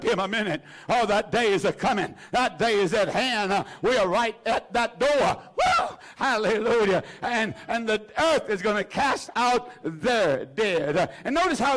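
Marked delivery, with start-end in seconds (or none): 5.6-6.0 high whooping cry of joy; a preacher's ecstatic woo; joyful whoop. Real, not acted. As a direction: let out a shout of praise. Preacher exults in worship.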